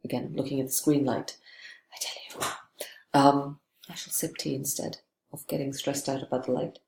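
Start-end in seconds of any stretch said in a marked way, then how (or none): 1.9-3.2 whispered aside. Real, not acted. Aside about the screen light.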